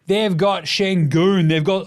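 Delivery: normal talking voice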